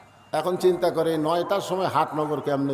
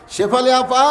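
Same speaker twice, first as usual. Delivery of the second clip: calling louder and higher from outside the door; 6 semitones higher, 7 dB louder than usual. Acted. Brother-in-law calling his sister at the gate, louder call. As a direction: call her again, louder this time.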